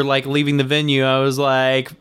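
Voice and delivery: ordinary talk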